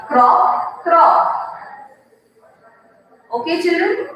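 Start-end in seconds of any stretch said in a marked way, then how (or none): 0.1-1.3 throaty frog croak; teacher repeats the frog sound. Shown as a demonstration. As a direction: croak like a frog again for the children. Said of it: "It says".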